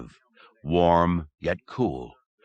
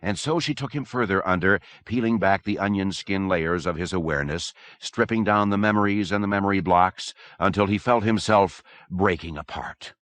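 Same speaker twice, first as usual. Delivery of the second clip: usual speaking voice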